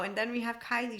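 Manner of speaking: ordinary delivery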